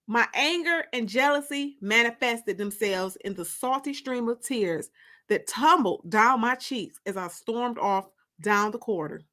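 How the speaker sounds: ordinary delivery, nothing marked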